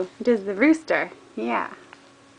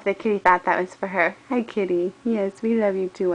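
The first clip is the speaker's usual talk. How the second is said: ordinary talk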